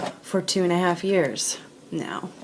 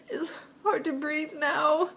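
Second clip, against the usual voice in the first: crying; 10 semitones higher than usual. Real, not acted. Pleading for help, breaking down.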